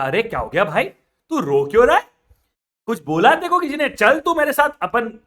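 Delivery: loud, high worried call; loud concern. Acted. Father baniya loudly asking why the Punjabi cries. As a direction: call out anxiously to a crying man.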